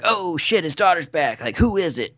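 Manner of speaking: lightly hammed-up, high-pitched teaser reaction; mock excitement. Podcaster mimicking the film's teaser ending, mock excited. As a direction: play a mock cliffhanger reveal, pitched high.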